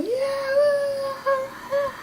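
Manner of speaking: high, drawn-out whoop; excited cheer. Acted. Cheering the hosts as the show opens, excited whoop. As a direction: let out a long high cheer.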